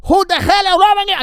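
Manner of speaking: high, loud Italian chef yelling in broken English; crazy Italian chef. Retold or imitated. Italian chef shouting at the waiter, high and loud. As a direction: bark it like a wild Italian cook, way up high. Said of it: "a crazy coked out guy from Italy".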